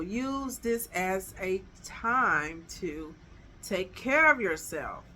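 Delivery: ordinary talk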